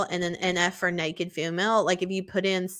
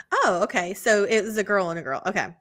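usual speaking voice

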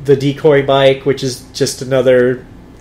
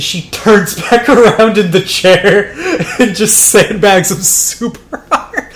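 laughing while telling; 6 semitones higher, slower than usual. Real, not acted. Player laughing at the scientist ignoring police, giggling. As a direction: talk through real laughter, higher.

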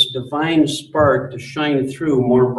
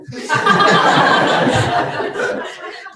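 high airy laugh. Real, not acted. Father laughing at daughter's story.

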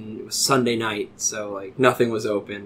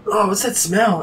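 higher-pitched waking complaint; mock disgusted question; 8 semitones higher than usual. Him coming to in the car, disgusted. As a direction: ask it with voice lifted in distaste. Waking groggy to vomit smell.

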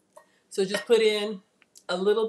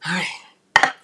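ordinary talk, nothing marked

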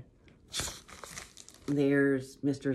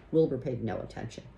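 plain reading voice

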